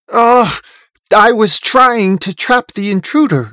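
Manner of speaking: exasperated, loud and high; snapping. A narrator's reading. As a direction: groan "ugh" and snap in frustration. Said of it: "Merneith snaps".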